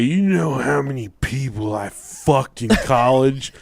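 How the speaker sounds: put-on slow drunken boast; drunk brother. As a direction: imitate a drunk brother drawling a brag. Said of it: "he was drunk and fall".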